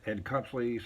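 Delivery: ordinary talk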